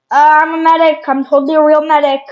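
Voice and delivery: fake medic voice. Pretending to be a medic.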